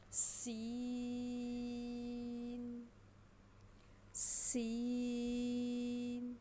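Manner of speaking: سین held long with a sharp hissing s, not thick; whistling seen. Shown as a demonstration. Teacher showing the sharp whistle-like سین. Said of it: "سیٹی کی طرح تیز ہوتی ہے".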